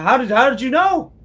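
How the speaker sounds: high, loud, delighted surprise; delighted trapped man. Acted. Trapped man thrilled at being called fine fellow, high.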